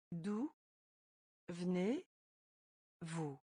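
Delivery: slow word by word. Shown as a demonstration. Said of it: "teacher splits phrase, stretches end".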